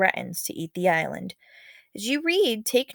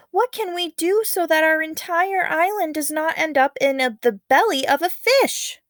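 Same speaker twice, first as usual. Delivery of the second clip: cried out; 8 semitones higher, 4 dB louder than usual. Acted. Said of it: "Nannetti cried".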